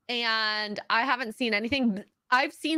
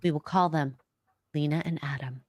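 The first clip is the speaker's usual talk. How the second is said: lower, hushed nature-documentary read; David Attenborough narration; 7 semitones lower than usual. Acted. Co-host reads a super chat as Attenborough, low narration. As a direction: narrate it low like a wildlife film. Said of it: "David Attenborough will narrate".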